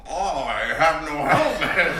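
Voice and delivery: laughing through a hammed-up swamp monster; swamp creature. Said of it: "he was doing a bog monster voice".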